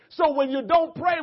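loud, driving preacher's call; preaching shout. Real, not acted. Preacher shouting at the congregation, loud and driving. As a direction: drive the point home to the church.

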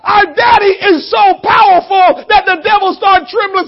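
normal talking voice

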